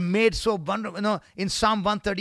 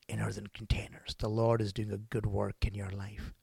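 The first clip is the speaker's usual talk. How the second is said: soft whispered prayer; 7 semitones lower, 6 dB quieter than usual. Acted. Preacher blessing congregation, whispering.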